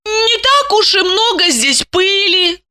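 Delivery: high woman's voice, unsure. A narrator's reading. Lipochka looks and admits there's little dust, unsure. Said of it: "без прежней уверенности промямлила".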